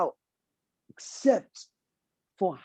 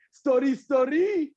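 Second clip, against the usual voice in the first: pitched-up storyteller's call; call and response; 7 semitones higher than usual. Shown as a demonstration. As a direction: call out story for the crowd to answer. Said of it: "when I say story, you gotta say story".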